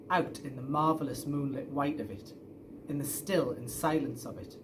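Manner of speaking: plain reading voice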